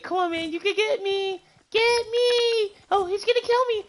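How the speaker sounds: put-on very high, loud bratty kid taunt; squeaky taunting child, peaking in a yell; little kid. Mocking little kids in online games, squeaky taunting kid voice. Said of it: "Don't you hate when little kids like".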